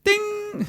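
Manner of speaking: high, sung elevator ding; ping it like the lift bell; the lift arriving, a high bright ding; elevator ding imitation.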